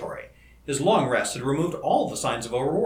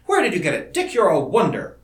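raised, emphatic, joyful wonder; amazed admiration; 5 semitones higher, 5 dB louder than usual. Acted. Colleague praising the inventor's discovery, joyfully amazed. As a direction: marvel out loud at a friend. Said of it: "changed to amazement and then to joy".

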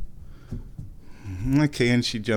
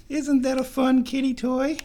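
much higher pet-talk voice; 14 semitones higher than usual. Real, not acted. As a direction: coo it to the kitten like it's a treat. Talking to the cat.

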